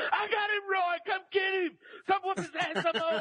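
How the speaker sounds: high, laughing mock shout of a mother; mama calling out. Mama holding the boy, calling to the dad, high laughing shout. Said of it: "my mama said".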